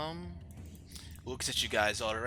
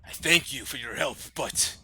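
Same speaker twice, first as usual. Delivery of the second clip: loud, raised royal voice; troubled, demanding king; 6 semitones higher, 7 dB louder than usual. Acted. King to his rescuers about his daughter, troubled and demanding. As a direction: press for answers, high and forceful.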